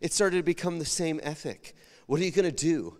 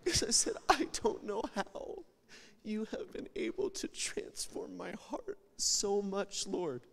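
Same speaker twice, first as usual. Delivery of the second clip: emotional whisper; 5 semitones higher, 8 dB quieter than usual. Real, not acted. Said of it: "And I get emotional".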